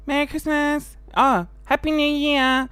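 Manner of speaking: a hint of a fake-happy well-wisher; mock cheery greeting. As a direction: ham up the forced cheer. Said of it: "something we're forced to say".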